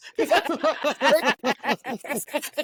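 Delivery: drawn-out rising spooky laugh; headless horseman laugh. Acted. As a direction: cackle like a ghost rider. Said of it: "very dramatic".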